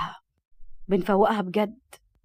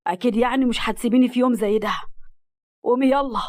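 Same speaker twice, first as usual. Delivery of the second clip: louder, rising panicked begging; desperate plea; 6 dB louder than usual. Acted. Murad begging his stabbed bride to get up. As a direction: shake her awake, pleading.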